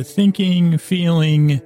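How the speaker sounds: normal talking voice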